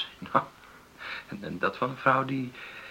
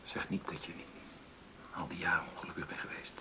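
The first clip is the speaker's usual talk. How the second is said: soft, whispered dread; hushed anxious plea; fearful whisper; 5 semitones lower, 14 dB quieter than usual. Acted. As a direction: beg quietly, afraid of the truth.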